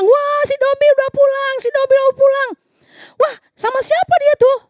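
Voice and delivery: high, loud happy cries; excited family. Acted. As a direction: cheer as the little one comes home. Family greets Dombi excitedly.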